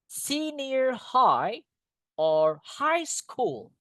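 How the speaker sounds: slow, clear model words with long pauses for the class; repeat-after-me drill. Shown as a demonstration. Teacher leading a repeat-after-me drill, slow and clear. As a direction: call out each word for students to echo. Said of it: "please repeat after me".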